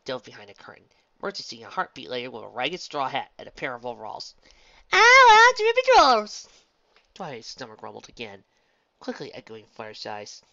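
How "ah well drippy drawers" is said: very high, loud whoop; mock country holler. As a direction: belt out a silly rustic exclamation. Pinkie mocks in costume, hollering.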